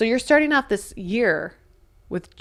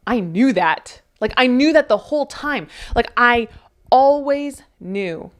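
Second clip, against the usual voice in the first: louder, higher cry of "I knew that", dragging out "always knew"; exasperated outburst; 5 semitones higher, 6 dB louder than usual. Acted. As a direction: burst out at yourself for having known all along. Acted exasperated realization quote.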